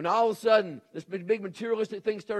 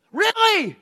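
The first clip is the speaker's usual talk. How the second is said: much higher and louder, a scoffing "Really?" falling hard; incredulous really; 12 dB louder than usual. Real, not acted. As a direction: toss back "Really?" in loud disbelief. Incredulous reaction to student's question.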